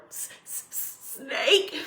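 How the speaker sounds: stuttered hissed start, then the word; dreading the word. Acted. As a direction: struggle to get a feared word out. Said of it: "I can't even say the word".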